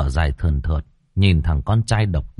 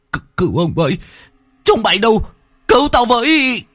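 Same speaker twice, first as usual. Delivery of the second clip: much higher, louder terrified screams; panicked cry for help; 4 dB louder than usual. Acted. Lý screams for servants as the beast bites him, terrified. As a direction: scream for rescue while being dragged away. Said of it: "rú lên đau đớn".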